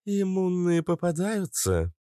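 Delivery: high-pitched, drawn-out hopeful ask; hopeful question. A narrator's reading. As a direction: ask slowly and high, hoping for a yes. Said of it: "с надеждой спросила нимфа". (с надеждой, высоким голосом)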